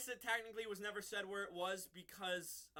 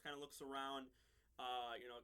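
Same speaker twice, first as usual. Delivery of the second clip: ordinary talk, nothing marked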